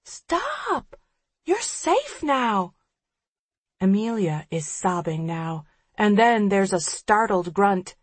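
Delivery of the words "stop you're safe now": crazed assurance. A narrator's reading. Killer's voice through phone.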